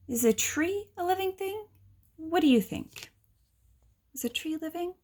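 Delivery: put-on high child's voice asking; curious child. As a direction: ask like a wondering little kid. Child asking narrator questions.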